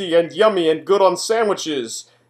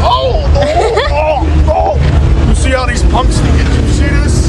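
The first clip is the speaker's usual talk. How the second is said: mock outrage; 8 semitones higher, 8 dB louder, slower than usual. Mock outrage at young punks.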